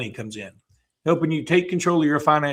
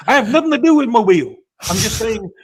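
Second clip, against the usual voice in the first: mock defensive protest; 8 semitones higher, 6 dB louder than usual.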